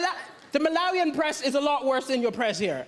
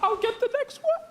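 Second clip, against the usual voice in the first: sheepish offer; 7 semitones higher than usual. Retold or imitated. Embarrassed offer at dinner bill.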